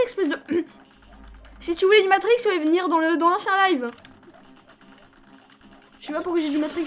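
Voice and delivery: ordinary talk, nothing marked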